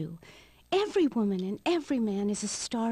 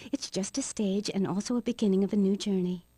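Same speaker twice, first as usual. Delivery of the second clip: usual speaking voice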